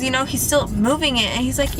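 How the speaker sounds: usual speaking voice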